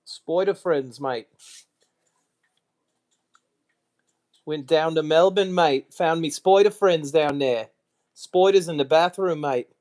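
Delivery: put-on 어설픈 미국식 Australian accent 흉내; a lame American trying an Australian accent, mate and all. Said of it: "I can't even remember how to do it".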